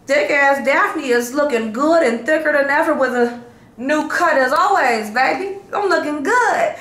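high, loud, sassy self-hype; hyped brag. Real, not acted. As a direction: show off and hype yourself up. Bragging hype about her looks.

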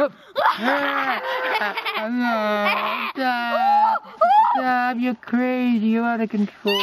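high, loud shouts half scared, half delighted; thrilled screaming. Real, not acted. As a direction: yell at the ride to stop. Kid screaming on a ride.

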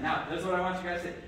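usual speaking voice

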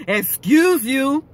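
slow, drawn-out dismissive "Excuse you"; rude "excuse you". Retold or imitated. Imitating a rude stranger's slow put-down. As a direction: stretch it out to put the other person down. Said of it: "it will feel more like insult".

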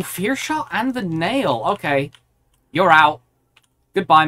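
ordinary delivery

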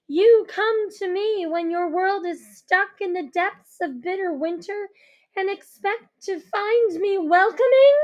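wounded queen accusing; bitter angry queen. Acted. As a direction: hurl the grievance at her.

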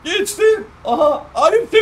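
sudden high yelp of disbelief; surprised. Real, not acted. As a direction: cry out as he gets passed. Friend passes him at the last moment.

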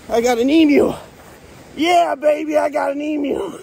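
high, exultant victory cry; triumphant. Real, not acted. Man who just caught an emu, triumphant whoop. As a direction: crow over a hard-won catch.

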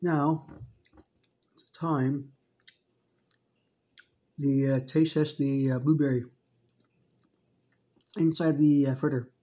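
food in mouth; speaking between chews; talking while chewing. Real, not acted. Seen 1: Chews between phrases throughout, holding the fritter; bite visible earlier.

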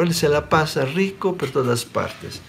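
normal talking voice

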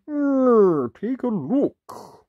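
high, slow friendly wolf; a helpful wolf offering a book. Acted. Wolf character speaks.

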